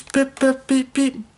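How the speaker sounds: high piped pip pip beep beep; imitated whistles. A narrator's reading. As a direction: toot like small trains. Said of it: "his friends blew their whistles".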